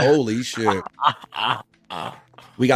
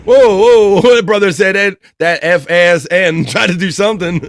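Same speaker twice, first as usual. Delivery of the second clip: loud, high whoas breaking into laughter; loud laughing shock; 5 semitones higher, 7 dB louder than usual. Real, not acted. Host reacting to the played gym audio, loud laughing shock. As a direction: cry out in amused disbelief, laughing through it.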